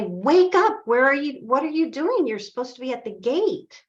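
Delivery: high, loud indignant demand; acted scolding. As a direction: rouse a shirking guard, starting loud. Villagers bang on door, scolding.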